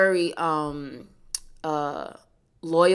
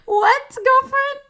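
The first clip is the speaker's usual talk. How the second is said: high, slow sassy exclaim; hammed-up sassy girlfriend; 9 dB louder, slower than usual. High drawn-out sassy call.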